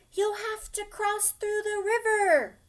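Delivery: high, loud call across the river; crying out. Acted. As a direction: townsfolk yelling over to the kids. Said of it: "cried the citizens".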